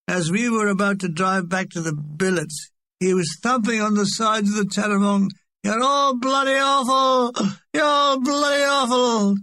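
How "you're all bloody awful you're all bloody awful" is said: put-on high, hoarse drunken bellow; drunken yelling. Drunk thumping the truck, yelling at the cast. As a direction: howl it at the departing truck.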